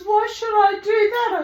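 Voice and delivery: high, loud imitated objection; acted protest. Neighbour objecting to inviting the cat in, loud high protest.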